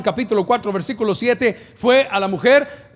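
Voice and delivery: ordinary talk, nothing marked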